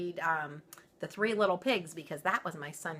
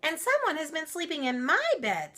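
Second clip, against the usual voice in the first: much higher fussy mother bear; mama bear voice; 12 semitones higher, 6 dB louder than usual. Acted. Mama Bear finding her bed used, high and fussing. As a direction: fret like a shocked mother bear. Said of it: "said the Mama Bear".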